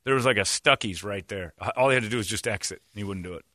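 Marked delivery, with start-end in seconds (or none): none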